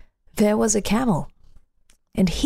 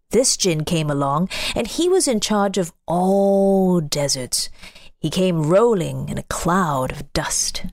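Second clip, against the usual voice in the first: usual speaking voice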